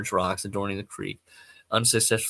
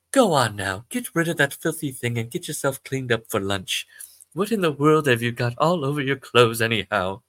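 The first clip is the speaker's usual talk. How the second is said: scolding British mum, louder; British mother; 3 semitones higher, 4 dB louder than usual. Acted. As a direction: order him off in a British accent. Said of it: "I don't know why she's British".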